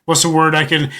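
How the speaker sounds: ordinary talk, nothing marked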